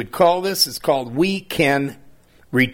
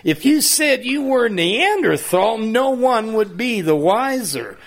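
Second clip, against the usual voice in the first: mocking taunt; 6 semitones higher than usual. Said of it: "They said".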